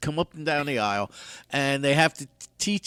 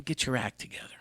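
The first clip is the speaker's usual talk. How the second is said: whispered, half-serious warning; 9 dB quieter, faster than usual. Acted. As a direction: whisper it like a secret. Whispered advice aside.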